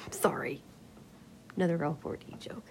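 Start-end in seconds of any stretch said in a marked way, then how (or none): none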